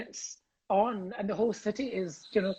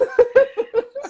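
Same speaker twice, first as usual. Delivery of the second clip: burst of laughter; 10 dB louder than usual. Real, not acted. Laughing at pronunciation.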